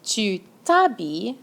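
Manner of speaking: ordinary delivery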